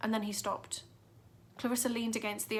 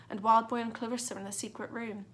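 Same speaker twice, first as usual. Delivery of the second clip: plain reading voice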